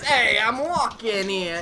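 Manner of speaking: slow, hammed-up New York accent; a bad New York tough guy, drawn out; lazy mock New York accent, like a cigar-chomping character; terrible New York accent. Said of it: "in a terrible accent".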